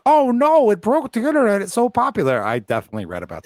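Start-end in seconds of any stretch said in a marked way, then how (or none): 0.1-2.4 high, loud mock panic; hammed-up fake alarm. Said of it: "and be like".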